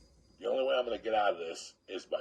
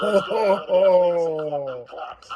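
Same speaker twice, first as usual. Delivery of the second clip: loud rallying call, slower; rallying shout; 8 semitones higher, 13 dB louder than usual. Retold or imitated. Mother rallying the bar crowd to come fight, loud shout. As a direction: fire up the crowd to storm in.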